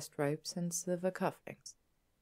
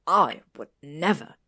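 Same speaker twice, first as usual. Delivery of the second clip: loud, snarled denial; 9 dB louder than usual. A narrator's reading. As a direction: snarl it, hurt and outraged. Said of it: "Draco snarled".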